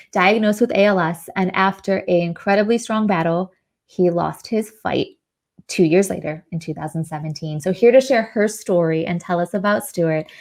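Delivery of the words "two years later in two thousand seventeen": ordinary talk, nothing marked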